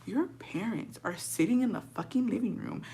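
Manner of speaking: urgent angry whisper, voiceless; hissed whisper. Retold or imitated. Whispering a scolding to the brothers near the living room. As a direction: whisper a scolding so the parents won't hear. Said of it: "I was like".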